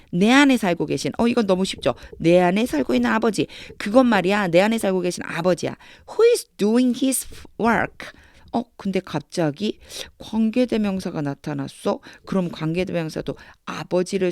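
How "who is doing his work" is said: slow reading. Shown as a demonstration. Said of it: "영어식으로 읽고 해석하는 연습".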